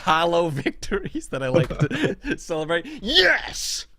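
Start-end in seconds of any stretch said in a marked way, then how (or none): 3.0-3.9 gloating cheer. Pretend. Host gloating at Dave Anthony, triumphant shout. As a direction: crow it at a beaten rival. Gloating at his online rival.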